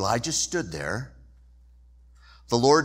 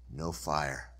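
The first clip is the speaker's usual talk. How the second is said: ordinary talk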